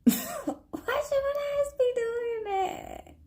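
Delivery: high, slow words squeezed out through laughter; laughing helplessly through the question; crying with laughter. Real, not acted. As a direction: laugh so hard the voice cracks up high. Laughing complaint about Geminis.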